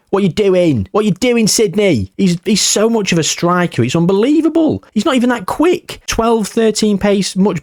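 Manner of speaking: incredulous rant at the club; exasperated. Real, not acted. Exasperated at team's player use.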